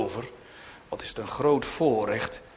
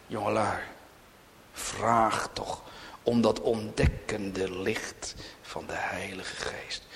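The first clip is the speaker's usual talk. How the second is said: pleading whisper to the youth; soft earnest plea; hushed whisper; 2 semitones lower, 3 dB quieter than usual. Real, not acted. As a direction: lean in and whisper it urgently. Pleading softly with young people.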